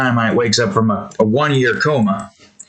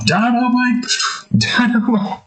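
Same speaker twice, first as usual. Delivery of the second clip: higher drawn-out calling; eerie calling voice; 11 semitones higher than usual. Retold or imitated. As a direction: call him back again. Summoning him again.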